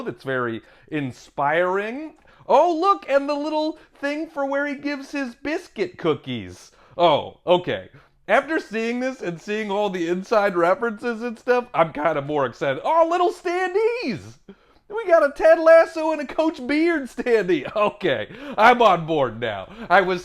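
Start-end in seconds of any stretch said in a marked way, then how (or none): 2.5-6.7 high, quick squeals of discovery; delighted unboxing chatter, pitched way up; excited delight. Real, not acted. 12.8-17.7 high, quick squeals of discovery; delighted unboxing chatter, pitched way up; excited delight. Real, not acted.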